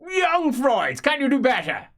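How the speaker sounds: high, loud berating; furious old magister. Acted. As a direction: tear into the apprentice. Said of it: "He says".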